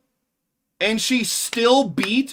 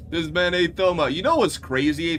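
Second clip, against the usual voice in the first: ordinary talk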